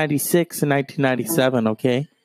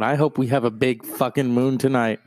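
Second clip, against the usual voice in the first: ordinary talk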